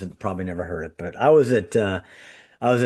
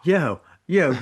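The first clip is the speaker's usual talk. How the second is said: a New York greeting, higher and jokey; mock New York yo; 6 semitones higher, 4 dB louder than usual. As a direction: tease him with a New York yo. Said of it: "with a New York accent".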